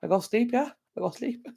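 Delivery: put-on high, childlike broken English; sleepy baby talk. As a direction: play a drowsy toddler announcing bedtime. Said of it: "I'm like".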